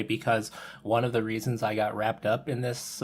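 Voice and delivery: normal talking voice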